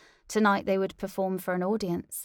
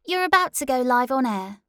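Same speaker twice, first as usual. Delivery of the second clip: producer call; 6 semitones higher, 8 dB louder, faster than usual. Acted.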